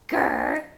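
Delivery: low growl; bear growl. Pretend. Mother shows bear sound.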